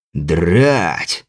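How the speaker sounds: low startled leprechaun curse; startled low grumble. Acted. Leprechaun startled, spills wine.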